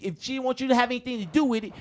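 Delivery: usual speaking voice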